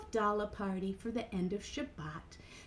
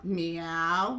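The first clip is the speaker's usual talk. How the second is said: lower-pitched cat call; low meow; 6 semitones lower than usual. Acted. Storyteller redoes the puppet's cat sound, low meow. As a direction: meow deep in your own register. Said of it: "Sometimes I forget if I'm Mimi or Ellen".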